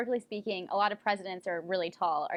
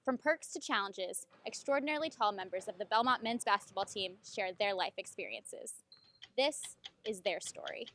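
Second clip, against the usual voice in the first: feature narration; 5 dB quieter than usual. Acted. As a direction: set up the story like a TV feature host. Host's opening feature narration.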